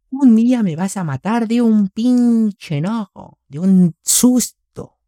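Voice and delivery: slow, drawn-out mock mother's scolding; scolding mom. Comic son quoting his mom's old threat, slow and scolding. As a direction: stretch out a mother's exasperated threat. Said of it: "O cuando te decían".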